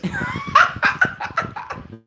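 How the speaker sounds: very high-pitched cackling laugh; real laughter. Real, not acted. Streamer tickled by fufu with dog leg, laughing hard. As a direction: burst into high, helpless laughter.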